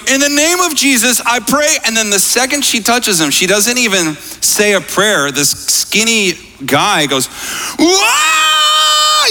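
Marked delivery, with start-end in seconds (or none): 0.1-1.8 imitated high-pitched, emphatic prayer; bold prayer. Young leader prays over a paralyzed man, bold prayer. 7.8-9.3 imitated long, very high scream. Skinny man suddenly healed stands up, imitated scream. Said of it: "starts screaming".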